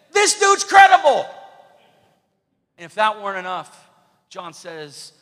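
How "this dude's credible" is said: very loud, high shouted endorsement; booming mock proclamation. God vouching for Jesus to the people, shouted proclamation. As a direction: shout it out as a divine announcement.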